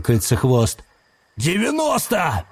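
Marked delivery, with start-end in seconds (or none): 1.4-2.4 hoarse cut-off. A narrator's reading. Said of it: "хрипло срезал его Сифака".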